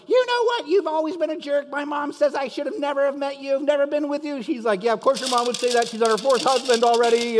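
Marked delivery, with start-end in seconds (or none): 0.1-4.4 very high screechy tirade; mock furious wife. 4.8-7.4 higher, louder spousal comeback; acted argument, pitched up; mock angry retort.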